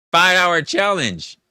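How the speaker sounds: laughs out the words, loud and high; cracking up while repeating the request; laughing. Real, not acted. Streamer laughing as he repeats chat's challenge, laughing.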